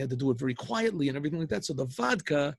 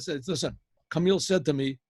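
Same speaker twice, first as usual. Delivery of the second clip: ordinary delivery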